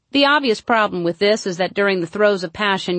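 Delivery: usual speaking voice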